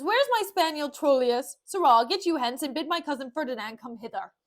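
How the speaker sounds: imperious theatrical orders; loud commanding. Acted. Petruchio sending servant for dog and cousin, loudly commanding. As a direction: fire off commands at full voice.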